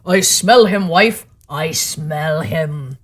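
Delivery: slow, menacing giant voice; the ogre slowly telling his wife he smells the boy; suspicious ogre. A narrator's reading. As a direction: draw it out, sniffing.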